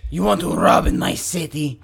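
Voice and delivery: a gravelly cowboy-hero voice doing the vigilante; Sam Elliott voice. Retold or imitated. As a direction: growl it out like a tough movie cowboy threatening robbers. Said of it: "Like, picture Sam Elliott".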